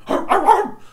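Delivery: high, loud barking like [name]; hammed-up [name] growl-bark. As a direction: bark it out like the rapper. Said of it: "Went D M X on this motherfucker".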